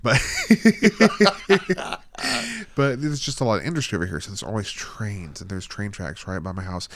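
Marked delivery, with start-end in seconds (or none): 0.2-2.7 high, loud peal of laughter; real laughing fit. Real, not acted. Host cracking up about living near industry, loud high laughter.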